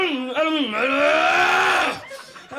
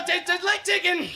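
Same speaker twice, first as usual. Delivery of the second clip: coaxing host; 3 semitones higher than usual. Retold or imitated. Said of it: "They go".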